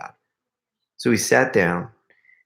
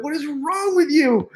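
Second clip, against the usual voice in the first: mock scream. Pranked man retelling his outburst at his friend, mock scream. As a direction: squeal it in mock outrage through amusement. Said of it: "And I started screaming".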